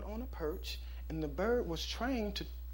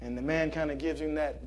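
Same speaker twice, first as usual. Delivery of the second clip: normal talking voice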